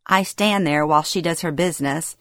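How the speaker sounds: usual speaking voice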